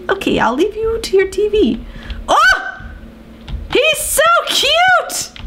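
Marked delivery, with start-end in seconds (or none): 2.3-5.3 squealing delight. Real, not acted. Fawning over a character.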